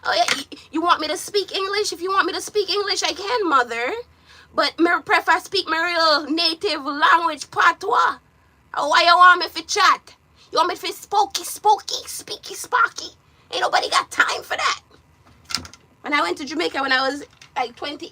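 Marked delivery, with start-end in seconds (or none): 0.0-11.4 higher, louder Jamaican patois. Retold or imitated. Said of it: "Me say". 11.4-14.8 high whispered mockery. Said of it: "Me say".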